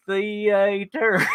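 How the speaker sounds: THEATER stretched into "theee-aay-terr"; drawn-out syllables. Retold or imitated. Volunteer trying out the pastor's word, syllables drawn out. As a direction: draw out each syllable of THEATER. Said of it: "How do you get that many syllables".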